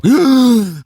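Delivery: a sudden high gasp of realisation; startled remembering gasp; sharp gasp. Retold or imitated. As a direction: gasp as if something just came back to you. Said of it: "I go".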